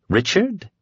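high rising disbelief; can that really be his name?; doubtful. Acted. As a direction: Nadine doubts the dark man's plain name. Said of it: "she asked doubtfully".

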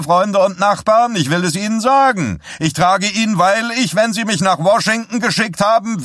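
a candidate preaching his program to a roaring crowd; rabble-rousing preacher. A narrator's reading. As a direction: whip the crowd up, stretching the punchline. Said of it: "aufpeitschende Sing-Sang-Stimme eines Erweckungspredigers".

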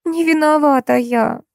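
high, slow plaintive whimper; drawn-out tearful protest. A narrator's reading. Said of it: "В трубке послышался тихий плач".

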